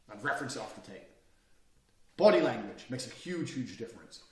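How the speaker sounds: usual speaking voice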